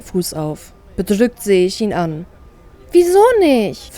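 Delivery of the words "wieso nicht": high, slow crestfallen question; pouting, let down; dejected. Acted. Sister asks her brother why not, pouting and dejected. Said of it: "frage ich geknickt".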